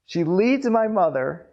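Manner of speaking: ordinary delivery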